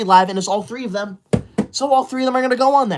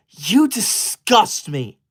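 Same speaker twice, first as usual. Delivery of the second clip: disgusted; much slower than usual. Acted. Reply to a mocking super chat.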